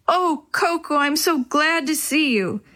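high, relieved exclamation; acted delight, pitch lifted; overjoyed reunion. A narrator's reading. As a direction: gush with gladness over a found pet. Boy reunited with his lost dog.

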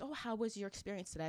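imitated greeter. Greeter asks departing shopper about her visit, imitated soft question. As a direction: ask it softly, as the greeter.

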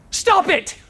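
sudden high scream of the words. A narrator's reading. Therapist suddenly screams at his patient. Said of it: "but then screams".

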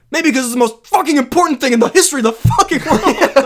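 hammed-up furious yell, high and loud; mock meltdown. Podcaster mimics Matt Damon's meltdown, hammed-up yelling. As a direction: rant like a hero losing it. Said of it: "He has a meltdown".

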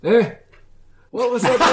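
put-on deaf old man voice. GM voicing deaf old resident.

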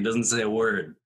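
ordinary talk

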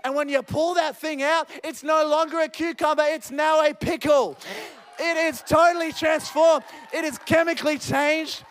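impassioned preacher in full flow; fervent preaching. Real, not acted. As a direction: drive it home to the congregation.

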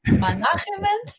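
"Management" as "ma-NA-khe-ment", pitched high and rising; high, unsure "Management" with a kh sound; imitated mispronunciation. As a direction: copy a learner's questioning "Management" with a throaty kh for the g. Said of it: "to copy it".

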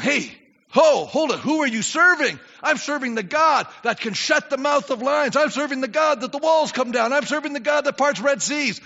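loud, high, forceful proclamation; bold preaching declaration. Acted. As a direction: hold it, then declare it with force. Preacher answers the worried believer.

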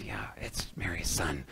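hushed whisper to a neighbor; whispered agreement. Retold or imitated. Townsperson whispering agreement in the synagogue, hushed. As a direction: whisper it like gossip in the pews. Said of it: "agree with me and nod".

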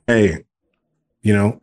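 ordinary talk, nothing marked

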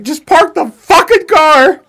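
imitating an exasperated, high-pitched shout; pissed-off yelling. Imitating Brie heated at stalled parallel parking in the ending, pissed-off yell. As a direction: yell it slowly, loud and high, as an enraged viewer. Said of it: "she'll get so pissed".

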